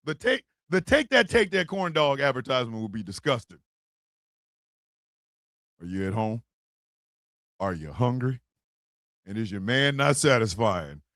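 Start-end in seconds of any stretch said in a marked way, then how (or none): 5.8-11.0 seduce the listener, then sell it; mock ad read. Pitching the corn dog factory, low sultry ad voice.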